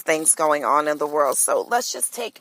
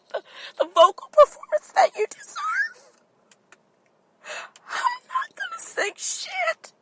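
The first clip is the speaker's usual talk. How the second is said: mock sobbing, much higher and strained; hammed-up crying. Host tearfully quits singing career as a joke, hammed-up sobbing. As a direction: fake a melodramatic breakdown in tears. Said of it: "I'm getting choked up".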